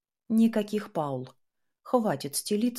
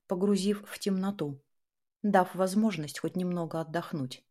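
ordinary delivery

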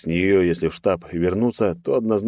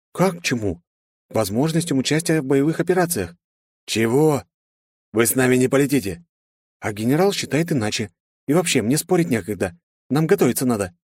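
put-on higher, busy young woman; brisk young woman; 6 semitones higher than usual. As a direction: brush him off quickly. Narrator voices Marina.

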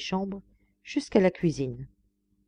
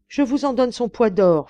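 raised, louder feverish offer; exalted; 5 semitones higher, 6 dB louder than usual. A narrator's reading. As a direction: bid wildly, carried away by adoration. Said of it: "fit l'exalté".

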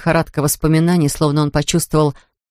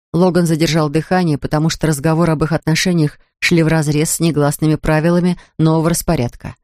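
ordinary delivery

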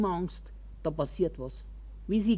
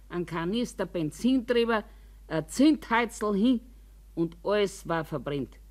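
normal talking voice

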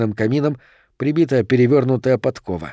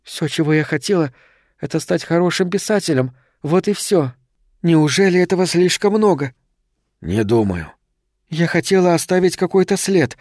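slightly trembling voice; 5 semitones higher than usual. A narrator's reading. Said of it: "Голос её слегка дрогнул".

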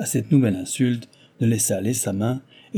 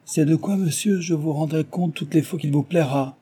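higher, cool disdain; same scornful tone; 6 semitones higher than usual. A narrator's reading. Belfield answering the chevalier with the same disdain. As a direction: return his contempt politely. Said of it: "sur le même ton".

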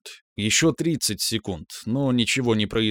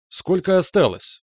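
character voice; 6 semitones higher than usual. Acted. Asks symbiote, voiced character.

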